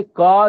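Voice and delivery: का stretched slowly and said loud as a model sound; teacher modelling का; drawn-out demo syllable. Shown as a demonstration. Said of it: "ये देखिए, ये शुद्ध रूप है".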